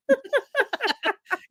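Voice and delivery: raspy high wheezing laugh from a cold; smoker's rasp laugh. Real, not acted. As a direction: let out a rough chain smoker cackle. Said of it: "I have my chain smoker laugh going on".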